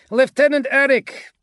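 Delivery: high, loud radio hail; hailing over vox. A narrator's reading. Agapito calls his lieutenant after victory, hailing over vox. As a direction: call up an officer by radio. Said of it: "Agapito voxed".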